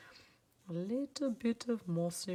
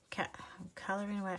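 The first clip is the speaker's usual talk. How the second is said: quick whisper; 5 dB quieter, faster than usual. Real, not acted. Coloring quietly.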